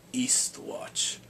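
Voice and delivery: whispered place name for suspense; hushed whisper. Real, not acted. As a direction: breathe it out as an ominous reveal. Ominous hushed scene change.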